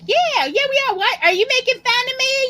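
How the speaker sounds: roommate voice. Retold or imitated. Imitating a roommate who snaps she isn't being mocked. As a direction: squeal it like a defensive roommate. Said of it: "she's like".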